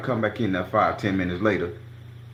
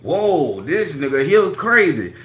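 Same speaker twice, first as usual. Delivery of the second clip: crowd reaction; 5 semitones higher, 5 dB louder, slower than usual. Retold or imitated. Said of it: "Everybody like".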